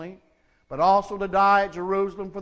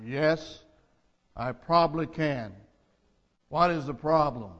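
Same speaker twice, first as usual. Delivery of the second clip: God answers the dangling tourist, calm and low; calm voice from heaven; 6 semitones lower, 4 dB quieter than usual. Acted. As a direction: speak down from the sky, unhurried and calm. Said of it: "the calm voice replied".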